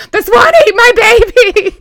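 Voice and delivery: a playful mock sob of a movie quote; mock wail. Quoting a favourite movie line.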